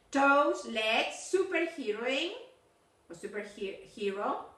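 usual speaking voice

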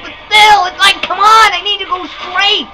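high, strained complaining; exasperated protest. Real, not acted. Defending own failed attempt.

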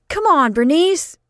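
high, loud eager call; eager cry. Acted. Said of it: "cried Otis".

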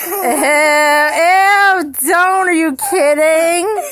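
loud grossed-out squeal; disgusted shriek. Real, not acted. Grossed out by armpit licking.

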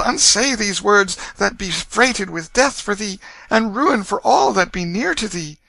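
high, urgent motherly entreaty; distraught mother, pleading. Acted. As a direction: beg a deluded child to remember. Narrator voices pleading mother.

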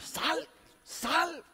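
high urgent command; disciples commanding. Retold or imitated. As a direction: order the demon out, lightly acted. Discípulos reprenden al demonio. (discípulos ordenando, agudo y urgente)

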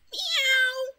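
high, held-out meow; one long kitten meow; cat meow. Pretend. Cat noise.

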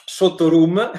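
hiccup catching the words; a hiccup cuts in mid-phrase. Real, not acted. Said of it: "Singhiozzo incredibile".

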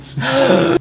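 sharp high gasp. Acted. Dramatic gasp while reading.

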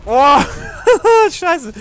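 real shocked yelp into a laugh; sudden loud surprise, then cursing; startled outburst. Real, not acted. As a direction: caught off guard, loud and laughing. Sudden game death, loud outburst.

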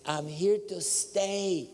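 quoted divine voice. Retold or imitated. God speaking to the seeker, slow emphatic declaration. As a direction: stretch each word as a proclamation. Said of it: "and he says".

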